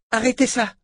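sharp, high-pitched exclamation; sudden high protest; crying out. A narrator's reading. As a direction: cry it out to make them stop. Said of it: "s'écria la sœur de Richard".

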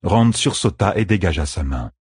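usual speaking voice

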